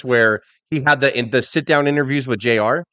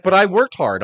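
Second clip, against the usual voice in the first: higher, pleading imitation of complainers; mock protest; 6 semitones higher, 3 dB louder than usual. Host mimics wrestlers defending their effort, mock protest. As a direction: imitate someone insisting on their own effort.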